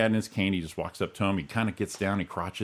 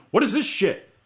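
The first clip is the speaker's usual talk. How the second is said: high, loud mock outrage; retold indignant outburst; 12 semitones higher, 5 dB louder than usual. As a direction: imitate an indignant dad, pitched way up. Dad confronting box boy with can.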